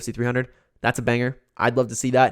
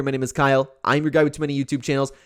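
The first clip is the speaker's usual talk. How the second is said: normal talking voice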